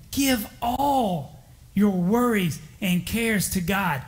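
slow, deliberate Give all your worries and cares to God; an underlined, slowed-down Give; stress on give. Shown as a demonstration. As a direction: lean hard on Give, draw the line out slowly. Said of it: "Underline that word in your heart".

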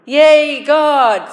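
loud, high jubilant shout; retold happy yell. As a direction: holler it down the jail hallway. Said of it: "yelled at me".

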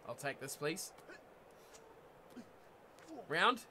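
normal talking voice

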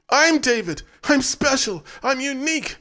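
high, loud frantic screams; screaming. A narrator's reading. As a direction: scream like a human child. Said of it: "David screamed like an Orga child".

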